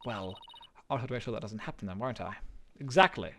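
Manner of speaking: soft, sinking voice of a lawyer who feels she failed; quiet despairing lawyer. Acted. Athena doubting herself after the mock trial, quiet and despairing. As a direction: let it drain out of her, hushed.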